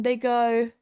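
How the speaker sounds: ordinary talk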